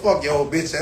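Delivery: ordinary talk, nothing marked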